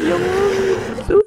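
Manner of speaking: high, drawn-out slow call; a kid calling out for mama; child upstairs calling mom for help. Acted.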